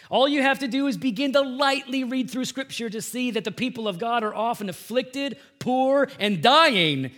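high, loud, emphatic sermon delivery; forceful preaching. Real, not acted. Preacher warning congregation against prosperity gospel, forceful preaching. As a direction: drive the warning home to the congregation.

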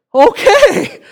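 very high, loud squeaky "okay"; taken-aback reply. Retold or imitated. Dad reacting to his mad son's yell, high comic alarm. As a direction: back off in a comic high-pitched voice.

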